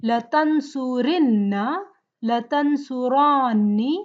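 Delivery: la tansuranna spelled out slowly, one form at a time; slow drill. Shown as a demonstration. Teacher drills the verb table slowly. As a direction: recite the table forms slowly for students to repeat. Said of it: "the table format for the verbs".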